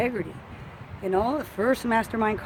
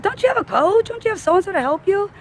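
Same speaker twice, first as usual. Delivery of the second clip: much higher, louder incredulous asking; surprised question; 10 semitones higher, 5 dB louder than usual. Retold or imitated. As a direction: sound amazed he didn't get help. Retelling a friend's surprised question.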